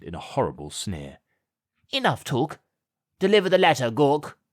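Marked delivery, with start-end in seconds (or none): 1.9-4.4 higher, curt imperious order; a grand lady cutting talk short; commanding. A narrator's reading. Lady orders Gawk to hand over the letter, imperious. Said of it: "commanded the lady".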